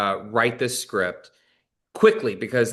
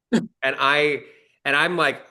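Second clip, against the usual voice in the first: ordinary delivery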